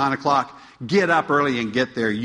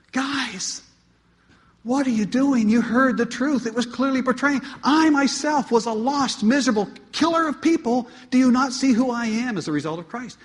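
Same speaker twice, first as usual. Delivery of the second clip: high, pleading, pushing each line; desperate Paul; 10 semitones higher than usual. Acted. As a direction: plead with the Galatians as their heartbroken teacher. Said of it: "hear the desperation in Paul's voice".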